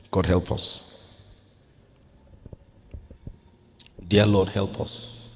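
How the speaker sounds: hushed, earnest prayer voice. Acted. Pastor leading couples in prayer, earnest and hushed.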